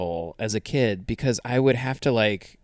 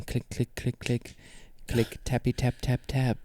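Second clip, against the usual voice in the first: ASMR clicks; 6 dB quieter than usual. Acted.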